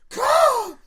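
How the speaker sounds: very loud, high squawked caw; bad deer cry. Drunk storyteller imitates the deer being hit, bad deer cry. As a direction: screech like a big bird for a deer. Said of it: "What sound did the deer make?".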